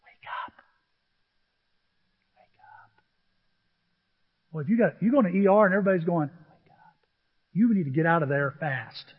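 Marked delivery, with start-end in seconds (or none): 0.0-2.9 hushed, far softer whisper; barely audible whispered urging. Acted. Hushed wake-up call, unvoiced. 6.3-7.0 tiny, hushed whisper; barely audible whisper; mock whisper. Everybody whispering at the ER.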